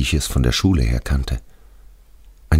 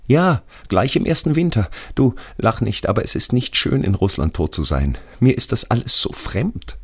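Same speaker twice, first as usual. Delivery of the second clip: hohe, bittende Stimme eines Gefallenen; ängstlicher toter Schulfreund; 8 semitones higher than usual. Acted. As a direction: als toter Freund scheu um Verständnis bitten. Radi pleads with narrator. (hoch, unsicher und eindringlich)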